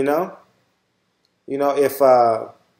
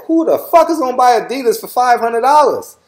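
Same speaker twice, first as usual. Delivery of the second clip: high-pitched, incredulous outburst; retold incredulity; 9 semitones higher, 3 dB louder than usual. Vlogger recalling his reaction to Yeezy prices, high and incredulous. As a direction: exclaim it in mock outrage, pitched way up.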